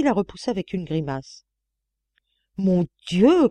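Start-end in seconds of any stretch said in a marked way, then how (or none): 2.6-3.5 louder exclamation of horror; appalled outburst, short of a scream; disgusted cry. A narrator's reading. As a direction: cry out, recoiling from a stench. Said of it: "cria-t-elle".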